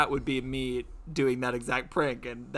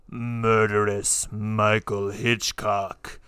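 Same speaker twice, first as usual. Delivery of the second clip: spooky announcer; 4 semitones lower, 5 dB louder, much slower than usual. Pretend. Spooky slow name.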